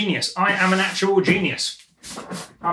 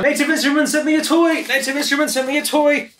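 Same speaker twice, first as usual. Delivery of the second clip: high, gleeful announcement of a new toy; excited; 7 semitones higher than usual. Real, not acted. Gleeful unboxing of a gift.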